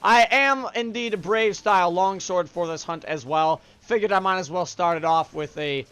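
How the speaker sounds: ordinary talk, nothing marked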